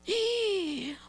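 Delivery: high mock gasp of shock; sharp put-on intake of breath. Pastor acting out being accused, shocked gasp.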